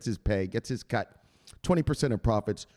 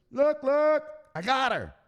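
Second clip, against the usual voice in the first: much higher excited boast; excited bragging call; 12 semitones higher, slower than usual. Acted. Calling buddies after winning Teresa, high excited boast. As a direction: brag to your buddies.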